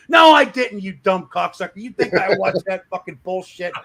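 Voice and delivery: loud fake-furious retort; mock angry shout. Arguing with the boss about the draft, hammed-up yelling. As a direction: snap back at him like a real fight.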